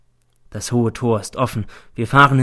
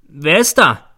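loud, higher call through a door; raised-voice challenge; 5 semitones higher, 7 dB louder, slower than usual. A narrator's reading. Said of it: "rufe ich durch die Türe".

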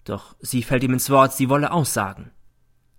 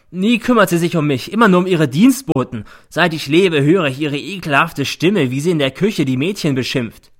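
loud, higher angry tirade; furious son; 6 semitones higher, 7 dB louder than usual. A narrator's reading. Son raging at his mother before the judge. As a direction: pour out years of anger.

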